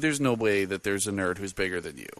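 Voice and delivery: normal talking voice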